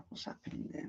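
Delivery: whispered puzzled question; baffled under the breath at the referee. Real, not acted. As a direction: breathe it out in disbelief. Confused at offside call.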